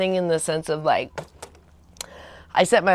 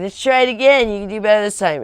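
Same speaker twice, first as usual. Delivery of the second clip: higher, louder coaxing push to go again; coaxing boyfriend coach; 5 semitones higher, 7 dB louder than usual. Retold or imitated. Boyfriend urging girlfriend back into the cold surf, coaxing higher and louder. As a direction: nudge her like a pushy surf coach. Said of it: "'Cause you'd be like".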